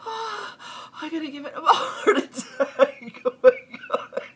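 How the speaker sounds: high, loud confession through laughter; real helpless laughter. Real, not acted. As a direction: gasp it out while cracking up. Laughing at accidental scare.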